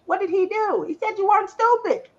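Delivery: usual speaking voice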